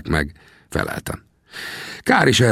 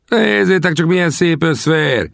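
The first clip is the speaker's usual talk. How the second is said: calling out; 11 semitones higher than usual. Acted. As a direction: shout across the camp, slowed. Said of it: "kiáltották többen is egyszerre".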